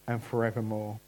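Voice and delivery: ordinary talk, nothing marked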